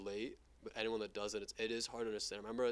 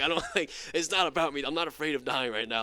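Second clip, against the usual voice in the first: high, loud, half-laughing objection; worked-up comic pleading; imitated panicked protest; 4 semitones higher, 10 dB louder than usual. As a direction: protest loudly, laughing at yourself. Retold protest while panicking, laughing.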